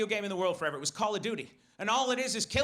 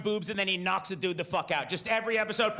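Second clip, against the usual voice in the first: ordinary delivery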